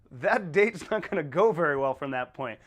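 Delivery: ordinary delivery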